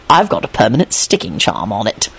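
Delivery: low, smug gloat; smirking. Acted. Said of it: "told him with a smirk".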